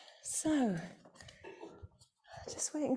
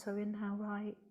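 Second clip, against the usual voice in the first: calm, measured yoga teacher voice, softer than chat; guided meditation; 4 semitones lower, 8 dB quieter than usual. Real, not acted. Yoga teacher leading the class through alternate nostril breathing, calm guided meditation. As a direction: guide the breathing with long pauses between phrases.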